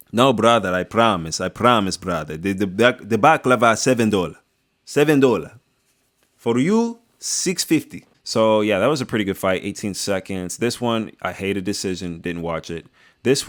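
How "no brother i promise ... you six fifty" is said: haggling baklava seller in a put-on foreign accent; hammed-up foreign-accented street vendor, insistent; mock foreign vendor accent.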